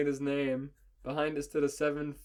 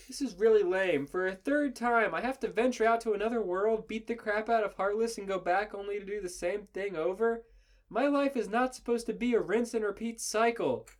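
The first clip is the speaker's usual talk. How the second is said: high, whining complaint; loud-edged, high-pitched griping; whiny Sora; 8 semitones higher, 4 dB louder than usual. A narrator's reading. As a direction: whine it as an exasperated young hero. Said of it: "Sora's kinda whiny now".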